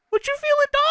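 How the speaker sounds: absurdly high squeaky mock outrage; Booker, enraged. Imitating an enraged wrestler answering his rival, squeaky mock outrage. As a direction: squeal it in a falsetto caricature.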